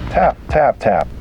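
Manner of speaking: ordinary delivery, nothing marked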